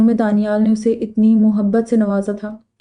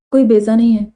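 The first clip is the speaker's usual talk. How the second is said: plain reading voice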